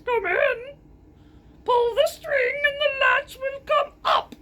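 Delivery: high squeaky wolf pretending to be granny. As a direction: squeak it out like a wolf faking an old lady. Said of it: "in a squeaking voice".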